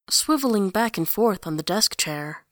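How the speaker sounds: ordinary delivery, nothing marked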